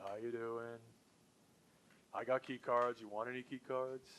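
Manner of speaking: mopey, low-energy sales pitch like Eeyore. Retold or imitated. Salesperson on the phone, droning like Eeyore. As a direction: drone it out like a gloomy donkey. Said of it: "the Eeyore complex".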